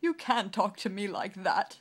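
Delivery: loud hurt protest; king sobs at her scolding; sobbing. Acted. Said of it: "sobbed the king".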